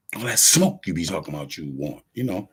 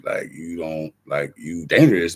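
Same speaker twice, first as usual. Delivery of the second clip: ordinary delivery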